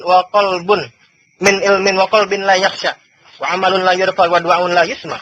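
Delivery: ordinary talk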